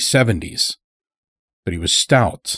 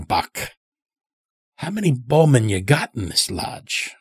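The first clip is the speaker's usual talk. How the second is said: put-on old farmer Morris, probing; 6 semitones higher than usual. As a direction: play a folksy old-timer delivering a pointed warning. Old captive farmer speaks in story.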